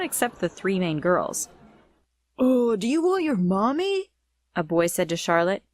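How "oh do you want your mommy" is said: put-on high teasing baby talk; mocking taunt. A narrator's reading. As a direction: tease like a schoolyard bully. Boy taunting bully victim.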